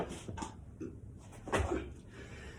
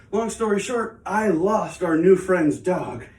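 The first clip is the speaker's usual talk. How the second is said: ordinary talk, nothing marked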